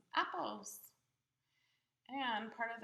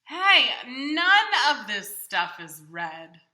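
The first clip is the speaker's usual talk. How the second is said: higher, louder, cheerful Big Bird puzzling over his bag; 4 semitones higher, 18 dB louder than usual. Acted. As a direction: play a big friendly bird talking to the child.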